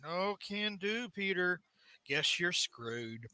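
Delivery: mock unbothered refusal. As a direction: drag it out quietly, utterly unhelpful. Acted villain's indifferent refusal.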